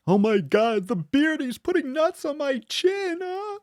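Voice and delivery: hammed-up falsetto panic; mock shocked squeal. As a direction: play a shrieking traumatized fan. Mock traumatized viewer.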